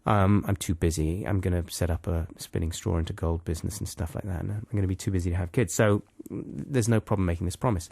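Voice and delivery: low, quiet musing; soft, quick, dismissive thinking aloud; offhand inner thought. Acted. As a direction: reason it away casually under your breath. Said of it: "'cause she thinks".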